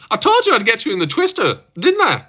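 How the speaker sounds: put-on high-pitched, laughing Irish accent. Streamer imitating a fighter gloating over a twister, Irish accent. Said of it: "But that was Irish".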